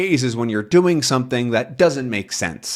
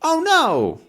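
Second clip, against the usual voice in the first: high, hammed-up fake distress; mock victim; 13 semitones higher than usual. Crooks pretending to be robbed, high hammed-up wail. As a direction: overplay a fake cry of theft. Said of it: "pretended like he took it".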